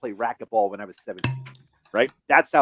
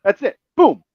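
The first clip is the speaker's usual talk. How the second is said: usual speaking voice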